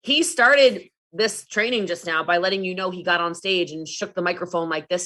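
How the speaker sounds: usual speaking voice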